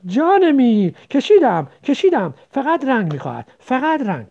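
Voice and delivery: put-on boy shouting that the drawing is done; joyful shout. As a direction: shout it out in triumph. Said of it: "فریاد کشید".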